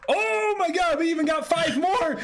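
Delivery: high-pitched delighted shout; excited. Real, not acted. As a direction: burst out with joy at the news. More gifted subs announced.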